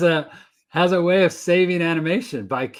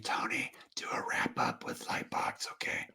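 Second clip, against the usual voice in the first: breathy whisper right in your ear; 16 dB quieter than usual. Pretend. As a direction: whisper it close into his ear. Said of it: "I'm gonna whisper it in the ear of the future me".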